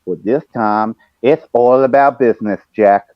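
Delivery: slow, grand wrestling promo voice; Dusty Rhodes impression. On the voice message, slow Dusty-style promo. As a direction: roll it out like a wrestling legend cutting a promo. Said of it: "Dusty-isms".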